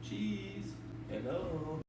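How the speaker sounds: gentle hushed lure; soft coaxing. Real, not acted. Vlogger luring the opossum out with cheese, soft and coaxing. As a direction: tempt it out quietly.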